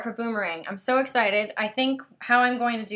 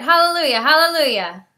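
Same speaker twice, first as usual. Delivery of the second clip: joyful relief; 5 semitones higher, 10 dB louder than usual. Real, not acted. Stream finally works, relieved.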